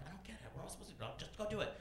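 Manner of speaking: hushed busboy exchange; mock hushed reply. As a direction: mutter back secretly. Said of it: "I'm like".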